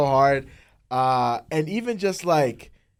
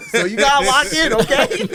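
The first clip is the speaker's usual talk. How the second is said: louder, higher, laughing through the quote; laughing mock pep talk; 9 semitones higher, 5 dB louder than usual. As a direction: play-act an urgent order, cracking up. Retelling the hero's words while laughing.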